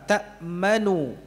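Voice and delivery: ta'manu drawn out slowly, nun clear. Shown as a demonstration. Teacher modelling ta'manu with a clear nun. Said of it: "yang pertama".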